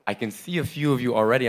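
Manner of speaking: ordinary talk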